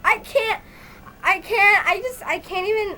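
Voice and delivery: high, loud, helpless squealing; real fangirl meltdown; overwhelmed breakdown. Real, not acted. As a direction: stammer it out, completely overcome. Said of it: "I'm gonna have a breakdown".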